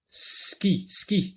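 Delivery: "Ski" said high and breathy, hissing the s; sounding out. Shown as a demonstration. Teacher isolating the s sound in "ski". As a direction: hiss the s of "ski". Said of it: "J'entends S".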